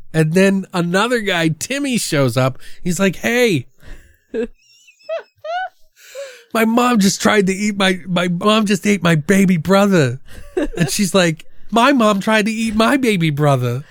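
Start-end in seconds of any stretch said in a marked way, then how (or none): 3.2-10.2 laughing retold line. 11.7-13.8 mock girl line. Girl's line retold.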